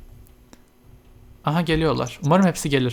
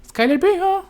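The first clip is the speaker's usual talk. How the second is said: much higher excited repeat; excited squeal. Real, not acted. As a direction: squeal that she's growing up. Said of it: "repeats much higher". (çok yüksek heyecanlı tekrar)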